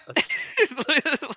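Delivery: a high, loud disbelieving laugh; high squealing laugh. Real, not acted. Laughing at what Europeans believe about American school tests, a high shriek.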